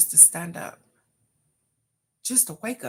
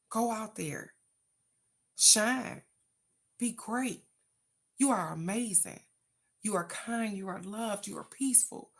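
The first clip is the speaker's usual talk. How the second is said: soft affirmations; 2 semitones higher, 3 dB quieter than usual. Real, not acted. Closing affirmations to viewers.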